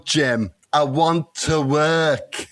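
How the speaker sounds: put-on very slow, drawn-out northern accent; slow mock northern soap voice; slow Manchester Liz impression. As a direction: drag each word out in a mild Manchester accent. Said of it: "you say it so slowly".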